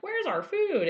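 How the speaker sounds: mock whiny customer. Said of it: "being like".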